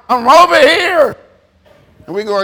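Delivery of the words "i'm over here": loud, insistent calling; God calling out. Acted. God calling to people who ignore him, loud. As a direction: call out to be noticed. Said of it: "And God said".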